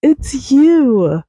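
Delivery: high, excited squeal of recognition; delighted surprise. Pretend. Host on a pretend call greeting a friend, squealing surprise. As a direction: gush like you just found your friend.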